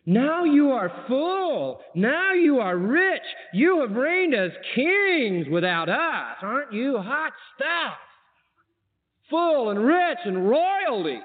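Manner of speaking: high, slow mocking sarcasm; sarcastic. Preacher mocking proud Corinthians, high and sarcastic. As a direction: drip with scorn as you read it. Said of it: "he gets so sarcastic".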